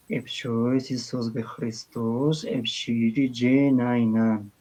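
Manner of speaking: slow, "Epshoi" opening the phrase; careful local Coptic, "epshiri jenainan"; slow Sahidic Coptic reading. Shown as a demonstration. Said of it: "pero si quiero decirlo en copto sagídico".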